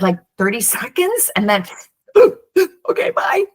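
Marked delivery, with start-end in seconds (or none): 2.1-2.8 high, loud mock gasp; playful imitated child's gasp, high; sharp gasp. Child's sudden recovery. 2.9-3.4 very high, chirpy mock child; high, playful little-child goodbye; happy kid. Child runs off happily.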